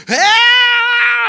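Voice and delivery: high piercing demonic scream; possessed shriek. Retold or imitated. Preacher voicing a demon-possessed girl's shriek.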